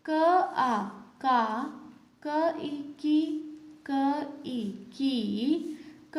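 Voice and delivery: very slow spelled syllables, क plus each vowel; slow syllable drill. Shown as a demonstration. Teacher spells out syllables for the class, slowly. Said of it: "क शब्द को हमने सब मात्रा के साथ".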